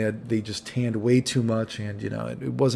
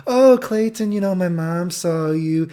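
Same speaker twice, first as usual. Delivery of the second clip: put-on high, drawn-out girly voice; imitated gushing girl; 6 semitones higher, 3 dB louder, slower than usual.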